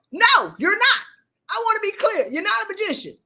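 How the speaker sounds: high, exasperated scolding; worked-up rant, pitched high; yelling. Real, not acted. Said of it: "I wasn't supposed to be yelling".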